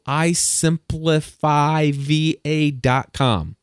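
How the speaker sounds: slowly spells out the web address; careful, drawn-out shout-out; slow, spelled-out plug. Shown as a demonstration. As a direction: spells out the URL so listeners can catch it. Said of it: "Shout out to".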